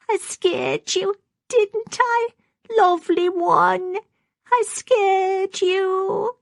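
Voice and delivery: put-on very high, slow, tearful old woman; snivelling. A narrator's reading. Maggie rocks her doll in her arms, snivelling. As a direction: snivel to the doll, drawing the words out. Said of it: "snivelling and saying".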